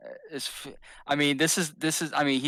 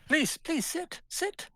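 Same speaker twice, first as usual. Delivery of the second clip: eager host voice; slower than usual. Acted. Host character urges guest to sit.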